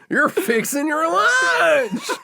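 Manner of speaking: very high, slow Southern squeal; mock Southern accent. Host mimicking his co-host, in a squealed Southern accent. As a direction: imitate the twang way up high, stretching LUNCH.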